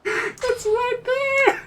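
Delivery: very high, drawn-out whine; agonised whine. Real, not acted. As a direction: wail slowly over a near miss. Agonising over near miss.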